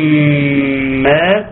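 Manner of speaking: long sustained hum on the م of لما; ghunna held. Shown as a demonstration. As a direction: draw out the nasal meem in لما. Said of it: "غنة تغني مقدار حركتين". (غنة ممدودة على ميم لما)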